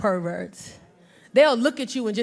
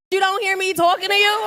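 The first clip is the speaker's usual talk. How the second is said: nagging phone; 5 semitones higher than usual. Retold or imitated. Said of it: "That phone was like".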